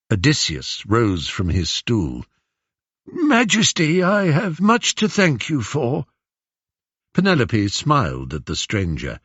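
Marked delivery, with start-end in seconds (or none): 3.1-6.1 put-on high-pitched old beggar, deferential; whining old man. Disguised Odysseus greeting the queen, old man's whine. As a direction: thank her in a reedy old man's voice. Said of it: "old man's hoarse whine".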